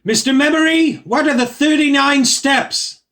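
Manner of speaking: shouted question to the stage; calling out. Acted. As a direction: demand it over the crowd. Calling out across crowded theatre.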